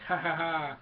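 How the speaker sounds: loud, triumphant mock laugh; hammed-up hearty cackle; Lando. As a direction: laugh it out like a victorious pilot. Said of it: "Lando's like".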